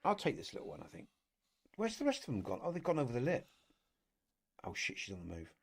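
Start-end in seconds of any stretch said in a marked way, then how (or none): 4.6-5.5 softly, almost under breath; hushed hunter. Real, not acted. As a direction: keep it down so the prey won't hear. Stalking prey in hunting game.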